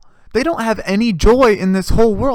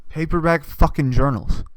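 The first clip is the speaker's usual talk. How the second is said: normal talking voice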